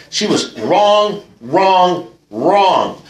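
WRONG drawn out and hit hard each time; retold righteous complaint, leaning hard on WRONG; indignant insistence. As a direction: hammer each "wrong" as its own long, emphatic beat. Retold indignant complaint about his wife.

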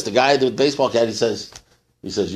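ordinary delivery

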